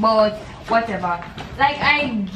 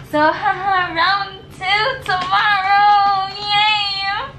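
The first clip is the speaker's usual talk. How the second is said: sarcastic fake cheer; 9 semitones higher, 4 dB louder, much slower than usual. Real, not acted. Sarcastic cheer about the pay.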